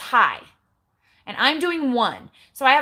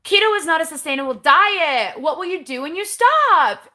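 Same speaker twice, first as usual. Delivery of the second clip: mocking the comment; 7 semitones higher, 6 dB louder than usual. Reading a hater's comment aloud.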